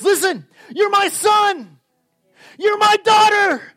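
high, loud, slow impassioned shouting; preaching at full force. Acted. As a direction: cry out the Father's love to the crowd.

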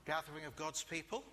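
ordinary delivery

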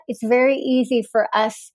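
ordinary talk, nothing marked

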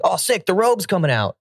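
high-pitched thrilled dog voice; excited dog. Retold or imitated. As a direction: pipe up high, mock-thrilled. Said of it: "And he's like".

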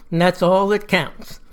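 ordinary delivery, nothing marked

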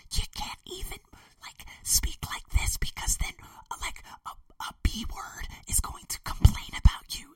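mock whisper, voice gone. Telling himself he must whisper in the new place, mock whisper. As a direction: whisper it as if afraid of neighbors.

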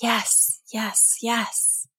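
soft murmured agreement; murmuring. A narrator's reading. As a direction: murmur it, barely heard. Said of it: "Several fish around the net murmured in agreement".

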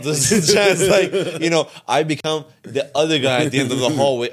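high-pitched, talking through laughter; laughing. Real, not acted. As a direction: joke through your own laughter, pitch rising. Joking about blacking out, laughs.

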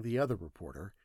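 ordinary delivery

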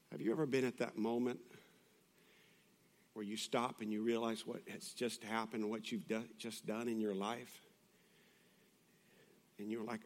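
soft, low, solemn sermon voice; hushed preaching. Real, not acted. Preacher reflecting on Peter's failure, hushed and solemn. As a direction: drop to a grave hush with long pauses.

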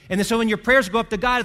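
usual speaking voice

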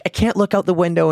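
high, pleading repeated apologies; apologetic frightened woman. Retold or imitated. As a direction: imitate the nervous driver begging forgiveness. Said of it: "And she's like".

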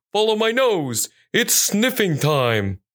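high start, then drawn-out words; eager sniffer dog. Acted. Police dog leading the search, eager high voice slowing down. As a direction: announce it like a keen dog hero.

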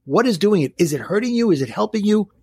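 ordinary talk

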